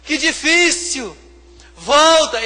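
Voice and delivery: high, loud, whining mock lament; mock whiny crying out. Pastor mocking believers begging Jesus to come back, loud whiny wail. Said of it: "ficamos assim".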